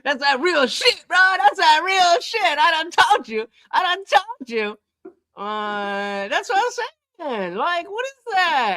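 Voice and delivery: high-pitched, excited ranting; bursting with excitement after the call; hyped. Real, not acted. Son to viewers after hanging up, excited and high.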